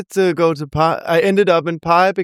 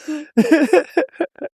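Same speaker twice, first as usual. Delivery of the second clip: high, airy giggle trailing up; light giggle; 10 semitones higher than usual. Real, not acted. Laughing after telling story.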